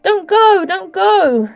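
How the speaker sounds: put-on a desperate girl crying after the creature; desperate exclamation. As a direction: cry out as he turns away. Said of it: "Derna exclaimed".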